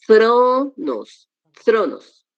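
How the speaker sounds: "thronos" with a drawn-out stressed THRO; stress on first omicron. Shown as a demonstration. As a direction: slowly model THROO-nos, then repeat "thronos". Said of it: "el acento en la primera Omicron".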